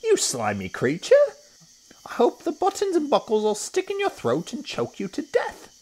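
high, loud squealed curse; outraged monkey cry. A narrator's reading. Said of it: "cried Mugglewump".

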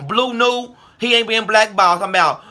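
loud scornful shout at a rival; shouted call-out. Real, not acted. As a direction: bark the rival's name in outrage. Calling out rival again.

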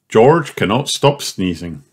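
ordinary delivery, nothing marked

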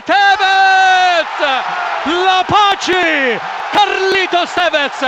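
long roaring goal call; goal shout. Real, not acted. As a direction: scream the scorer's name.

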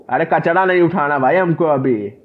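ordinary delivery

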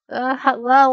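loud high startled cry; startled exclamation. Real, not acted. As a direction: burst out in surprise. Exclaims while reacting to the model line.

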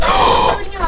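high-pitched sharp gasp; shocked gasp. Real, not acted. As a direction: suck in air in shock. Shocked by what appears in game.

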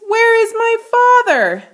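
high, loud frightened question; panicked cry. A narrator's reading. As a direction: call out in panic for father. Boy panics after transformation.

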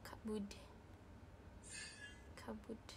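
a soft, fading whispered echo; whispered repeat. Real, not acted. Muttering to herself after the game, whispered.

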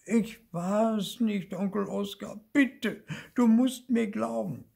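very high begging voice; high, whiny plea of innocence; pleading little ghost. A narrator's reading. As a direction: beg your uncle to believe you. Small ghost pleads with uncle.

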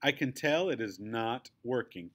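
ordinary delivery, nothing marked

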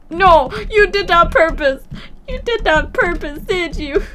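loud indignant whine; mock-outraged accusation. Real, not acted. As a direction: playfully blame the game. Accusing the game.

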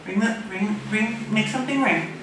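ordinary talk

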